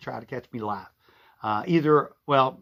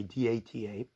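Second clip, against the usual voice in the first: ordinary delivery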